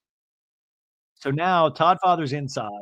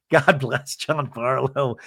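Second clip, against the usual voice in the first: normal talking voice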